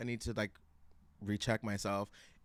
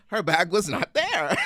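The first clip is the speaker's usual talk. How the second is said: laughing; 9 semitones higher, 10 dB louder than usual. Real, not acted. Laughing at the bad news.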